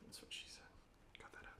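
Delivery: soft, unvoiced aside; cracking an off-mic joke to cohosts, whispered. Real, not acted. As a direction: slip the joke in under your breath.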